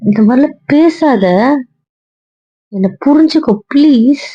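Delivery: desperate pleading. Acted. Pleading with angry wife.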